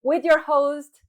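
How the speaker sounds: grand show opener; announcer intro. Acted. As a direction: sell the show title like a radio host.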